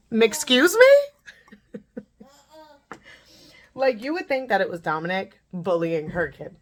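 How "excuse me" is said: high, loud, drawn-out mock indignation; with an attitude. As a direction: imitate an affronted mom, stretched and rising sharply. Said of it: "she comes to me with an attitude".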